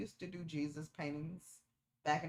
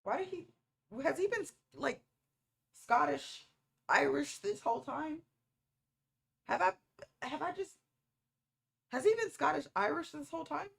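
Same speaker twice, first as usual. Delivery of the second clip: high, loud incredulous question; suddenly realising he's been Scottish; shocked disbelief; 10 semitones higher, 7 dB louder than usual. Real, not acted. Shocked realisation about the accent.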